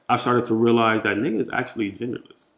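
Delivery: usual speaking voice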